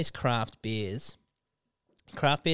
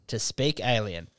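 ordinary talk, nothing marked

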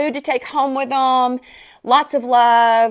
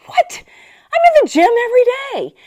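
high, louder, incredulous what; indignant surprise; 13 semitones higher, 6 dB louder, much faster than usual. Retold or imitated. Friends tell her to hit the gym, she answers in high indignant disbelief. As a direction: protest in disbelief.

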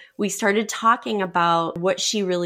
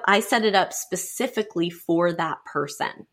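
usual speaking voice

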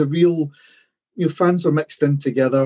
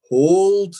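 drawn-out battle command, climbing higher each time; captain's shout; 7 semitones higher, much slower than usual. Retold or imitated. Fan retelling Braveheart's captain ordering troops to wait, imitated rising shout. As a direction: imitate a captain holding the line, rising pitch. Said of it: "one of the captains shouting".

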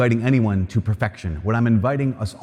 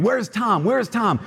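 much higher, mock tearful calling out; retold sobbing call; 10 semitones higher, slower than usual. Rick running from the chapel calling for Tom, retold sobbing. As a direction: imitate a weeping man searching. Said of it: "just sobbing".

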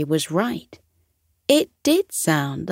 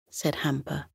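ordinary delivery, nothing marked

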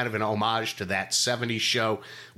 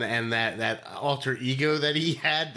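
ordinary talk, nothing marked

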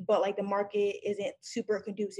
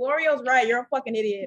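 normal talking voice

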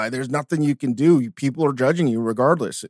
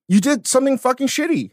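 mock accusing; 9 semitones higher than usual.

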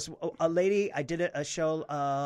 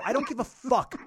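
raised, louder defiant retort; angry defiance; 8 semitones higher, 5 dB louder than usual. Retold or imitated. Comic retelling the awkward silence, raised defiant voice. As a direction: throw it back at the crowd, higher and louder.

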